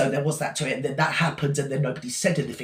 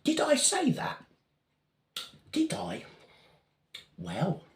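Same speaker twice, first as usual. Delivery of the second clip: much higher, slow, wide-eyed question; mock innocent; 8 semitones higher, 6 dB quieter, much slower than usual. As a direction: play dumb, drawn out and high. Feigned innocence after a pause.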